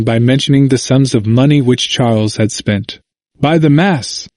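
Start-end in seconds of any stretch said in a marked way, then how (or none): 3.4-4.3 understated, higher-pitched royal exclamation; mild exclamation. A narrator's reading. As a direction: exclaim it lightly, pitch lifting. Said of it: "exclaimed the king".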